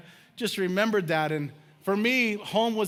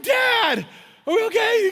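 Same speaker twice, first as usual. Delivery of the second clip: high, loud frightened cry to dad; acted kid yelling for reassurance; hammed-up scared shout, pitched way up; panicked calling out; 7 dB louder than usual. Retells frightened call to dad.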